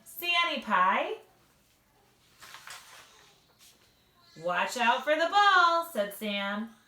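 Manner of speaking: lively read-aloud with character voices; bright storybook reading for little listeners; reading to children. Acted. Mom reads a picture book to second graders, lively and animated.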